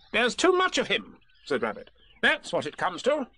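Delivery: high, bossy little rabbit voice; fussy Rabbit. Acted. Rabbit pressing Piglet to agree, bossy and high. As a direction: lay out the plan like a busy organiser.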